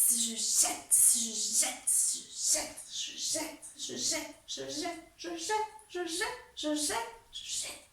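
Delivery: strained, half-whispered on held breath. Shown as a demonstration. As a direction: squeeze the words out with no air. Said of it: "je bloque la respiration".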